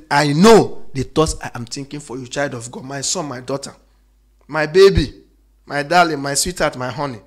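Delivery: loud, swelling voice for God's loving words; preaching. Real, not acted. Preacher voicing God calling his children, loud and swelling. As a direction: call out God's endearments to the church.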